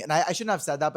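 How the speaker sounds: ordinary delivery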